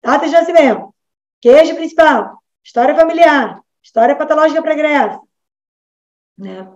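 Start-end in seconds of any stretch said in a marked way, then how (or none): 0.0-5.2 high, recited checklist tune; mock rote questionnaire. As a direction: rattle off form headings like a routine interview. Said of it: "sai daquela coisa".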